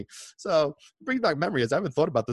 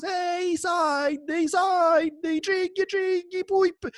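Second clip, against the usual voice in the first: very high shouted street cry; fish seller screaming; 4 dB louder, much slower than usual. Retold or imitated. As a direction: bellow your catch from the Vespa. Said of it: "screaming at the top of his lungs".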